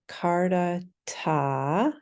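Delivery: very slow "Kardata" with a long first A; stretched-out Kardata. Shown as a demonstration. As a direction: draw out every syllable of "Kardata". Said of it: "longer A".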